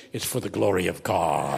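low, pious preacher voice; mock piety. Preacher at a preach-off denying rivalry, mock piety. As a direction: put on humble holiness. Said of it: "You say".

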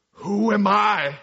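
loud, drawn-out booming father; imitated fatherly voice. Father answers his son's news over video, imitated booming fatherly voice. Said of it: "in his deep fatherly voice".

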